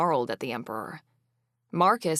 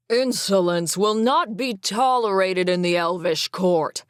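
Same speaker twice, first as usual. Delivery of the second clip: yelling; 3 semitones higher, 6 dB louder than usual. Acted. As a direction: bellow it down at the court. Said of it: "Cameron yelled".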